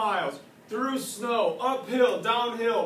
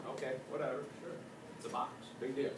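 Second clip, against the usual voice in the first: low, soft, offhand agreement; dismissive; 6 semitones lower, 11 dB quieter, much faster than usual. Acted. Emperor agreeing to the casket condition, dismissive. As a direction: mumble it away like it's nothing. King brushes off first condition.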